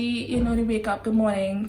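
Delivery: ordinary delivery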